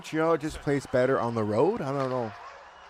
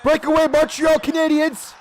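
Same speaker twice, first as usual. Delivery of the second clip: much higher, much louder breakaway call; excited call; 9 dB louder than usual. Real, not acted. Streamer calling a hockey breakaway, a thrilled high shout. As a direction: shout it like the play is happening now.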